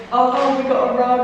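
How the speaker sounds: loud, delighted cry; thrilled the parcel came; excited. Real, not acted. Rug delivery just arrived.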